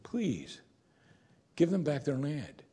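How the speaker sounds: soft, imploring please, lifted high on each please; gentle pleading. Acted. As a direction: plead softly, not scolding. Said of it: "Then twice he says".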